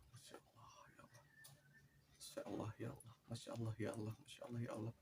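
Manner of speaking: soft repeated invocation, starting near a whisper; hushed, retold praying under the breath; murmured prayer. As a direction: murmur it to yourself like dhikr. Retells murmuring dhikr under threat.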